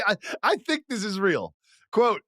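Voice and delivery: ordinary talk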